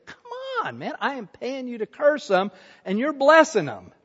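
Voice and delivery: high, softened whine of mock protest; exasperated pleading. King to hired prophet who keeps blessing, exasperated protest. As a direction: act out exasperation, starting high and small.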